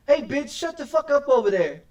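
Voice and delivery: loud, high, fed-up shout at someone across the room; yelling across. Real, not acted. Gamer yells at a noisy customer in the game, loud and high. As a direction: bark it over there to shut them up.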